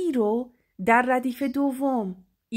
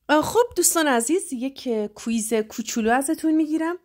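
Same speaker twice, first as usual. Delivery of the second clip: normal talking voice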